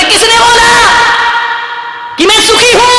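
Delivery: high voice protesting; who says I'm happy, high and hurt; indignant swan. A narrator's reading. Swan denies being happy. (ऊँची आवाज़ में हंस का विरोध)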